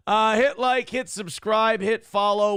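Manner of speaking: energetic host call to subscribe; loud plug. Real, not acted. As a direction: pump up the audience. Calling out a plug.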